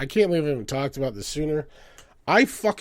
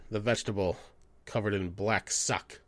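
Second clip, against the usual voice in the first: ordinary delivery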